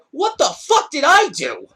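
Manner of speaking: high, loud, drawn-out mock outrage; acted baffled protest. Teller to staring onlookers, loud acted bewilderment.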